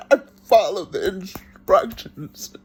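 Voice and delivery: ordinary delivery, nothing marked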